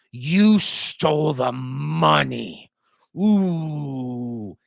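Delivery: slow, drawn-out scolding with a long "ooh"; disapproving tone. Shown as a demonstration. Lecturer showing disapproval without claiming it, disapproving tone. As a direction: stretch out each word in exaggerated disapproval. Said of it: "saying it in a disapproving way".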